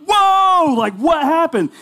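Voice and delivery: high, loud startled whoa; replaying a shocked yelp at the eye exam flash. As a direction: jump at a sudden flash of light. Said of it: "It was involuntary. I just went".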